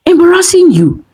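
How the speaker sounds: high, outraged bark. Acted. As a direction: throw his words back at him, indignant. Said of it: "Kemi barked".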